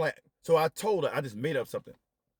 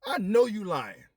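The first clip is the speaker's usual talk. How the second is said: higher, louder, slow disbelief; louder, higher slow disbelieving accusation; incredulous outburst; 9 semitones higher, 4 dB louder, slower than usual. Retold or imitated. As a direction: call out the lie in shock. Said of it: "I'm looking like".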